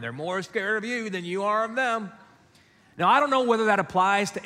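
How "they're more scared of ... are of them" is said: high mom voice, lightly done; mock motherly refrain; imitated mom. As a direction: recite mom's stock line in her higher pitch. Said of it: "that's her line".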